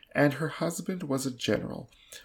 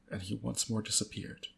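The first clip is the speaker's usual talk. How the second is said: plain reading voice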